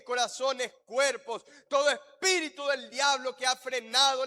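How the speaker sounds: loud, driving intercession; fervent prayer. Real, not acted. Pastor praying over the congregation to rebuke evil spirits, fervent prayer. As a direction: pray with insistent force, hammering each phrase.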